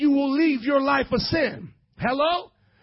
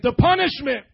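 shouted, louder and with force; said like you mean it; 3 semitones higher, 6 dB louder than usual. Shown as a demonstration. Preacher showing the congregation how to mean it, shouted. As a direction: belt it so they feel it. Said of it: "Say it like you mean it".

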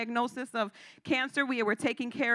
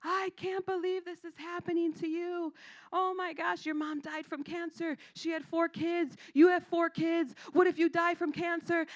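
hysterical friend; 6 semitones higher, 3 dB quieter than usual. Retold or imitated. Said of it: "she is hysterical".

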